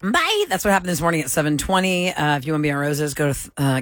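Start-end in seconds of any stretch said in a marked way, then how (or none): 0.0-0.4 high-pitched bye. Real, not acted. Group goodbye to caller.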